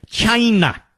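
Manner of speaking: "China" said like Trump, a hard CH and a drawn-out first syllable; Trump-style "China", nasal and drawn out; mock Trump China. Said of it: "Or as we like to say".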